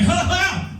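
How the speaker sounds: high startled fake laugh; nervous squeaky laugh; voice jumps an octave. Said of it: "my voice jumped an octave".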